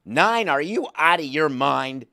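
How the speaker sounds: loud, high mock disbelief; mock outrage. GM balking at the agent's price, loud mock outrage. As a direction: bark back like a shocked GM. Acted outraged negotiation reply.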